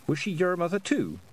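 ordinary delivery

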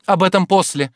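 high, loud, impatient cut-in; impatient interruption. A narrator's reading. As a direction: brush the question aside impatiently. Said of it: "перебила она нетерпеливо". (нетерпеливо перебивая)

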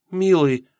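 high, emotional cry; impassioned endearment. Acted. Yulka throws herself on Misha's neck, impassioned cry. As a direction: burst out to your sweetheart. Girl embraces her beloved.

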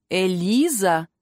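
slow, drawn-out call of the name; calling to rouse. A narrator's reading. Magister trying to reach his fainting student, slow mild call. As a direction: stretch the name out to get through. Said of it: "сделал несколько попыток докричаться до меня".

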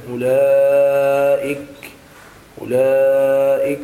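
أولئك loud and slow, long held "laa"; measured elongation. Shown as a demonstration. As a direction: hold the madd in أولئك on a level tone. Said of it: "مراعاة ضبط المدود".

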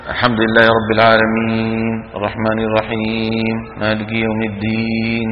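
low, soft, slow recitation with the long ee of العالمين and الدين squeezed indistinct. Retold or imitated. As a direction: imitate a recitation through a barely moving jaw, quietly dragging العالمين and الرحيم. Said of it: "عدم خفض الفك السفلي". (تلاوة خافتة للعالمين والدين من غير خفض الفك)

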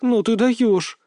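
high, astonished remark; amazed and taken aback; shocked exclamation. A narrator's reading. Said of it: "в шоке высказалась Рыжова".

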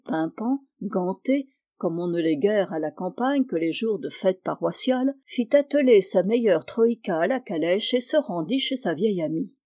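ordinary delivery, nothing marked

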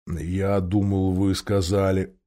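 low, soft, very slow bear voice; puzzled bear, slow and low. Acted. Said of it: "проворчал он".

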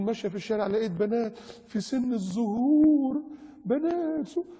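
mock shocked woman. Preacher mimics a woman writer seeing veiled girls, mock shocked. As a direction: imitate her scandalised account in a high voice.

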